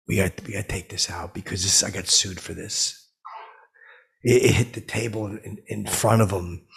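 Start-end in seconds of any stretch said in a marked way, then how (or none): 0.1-3.1 whispered aside. Real, not acted. Aside to cut material.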